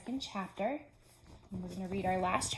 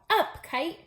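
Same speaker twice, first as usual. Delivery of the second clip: higher, louder shout; hearty shout upward; 8 semitones higher, 6 dB louder than usual. Acted. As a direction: call the kite up into the sky. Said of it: "and shout".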